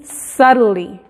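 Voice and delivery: "subtly" said very slowly, sounding like sully; teacher demo; flapped tl. Shown as a demonstration. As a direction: draw out "subtly" with the tl almost an r. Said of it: "Porque es la combinación de la T y la L".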